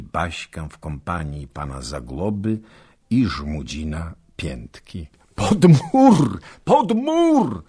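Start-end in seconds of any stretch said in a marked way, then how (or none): 5.3-7.6 urgent high shouted command; shouting. Acted. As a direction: yell them to take cover. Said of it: "krzyczał mały rycerz". (krzyk rozkazu, głośno i wysoko)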